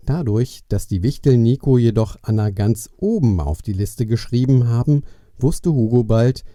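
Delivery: ordinary delivery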